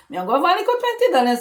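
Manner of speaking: lightly voiced mother, fast; mother's reply. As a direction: rattle it off as mum. Mother voiced in retold exchange.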